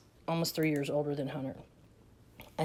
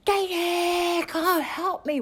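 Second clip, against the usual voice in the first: much higher, louder mock yell; mom's loud silly yell; 13 semitones higher, 6 dB louder, much slower than usual. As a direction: bellow the name to embarrass the kids. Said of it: "acting mentally challenged at high volume".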